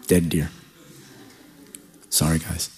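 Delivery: low, soft, understated; mock-solemn hush. Hunter after the kill, low solemn hush. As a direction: drop deep and quiet for the aftermath.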